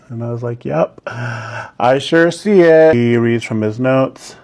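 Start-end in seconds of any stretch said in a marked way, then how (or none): 0.6-2.9 sarcastic. Retold or imitated. Sarcastic reply to boss.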